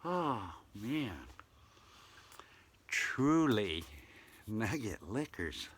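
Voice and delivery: usual speaking voice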